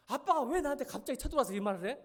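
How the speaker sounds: put-on child talking back to dad about studying; kid protesting. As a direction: argue back at dad like a sulky teen.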